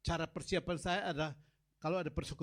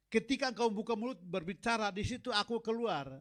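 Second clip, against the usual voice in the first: "Ketika engkau membuka mulut" said faster and louder, pressing harder; louder proclamation; 6 semitones higher, 6 dB louder than usual. Shown as a demonstration. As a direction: drive the repeated line home, faster. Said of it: "itulah aku". ("Ketika engkau membuka mulut" diulang lebih cepat dan lantang)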